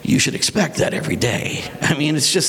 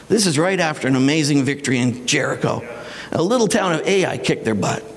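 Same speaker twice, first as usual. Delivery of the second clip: normal talking voice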